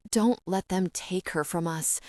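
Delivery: usual speaking voice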